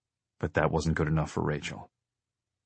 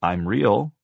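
mildly amused operator; lightly amused, raised reply; a little amused; 4 semitones higher, 6 dB louder than usual. A narrator's reading. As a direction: humor the child slightly. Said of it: "sounding a little amused".

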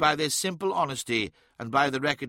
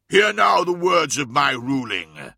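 Emperor's proclamation; 7 dB louder than usual. Acted. Emperor proclaims his ruling.

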